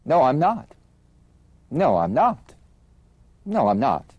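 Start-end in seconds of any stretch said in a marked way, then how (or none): none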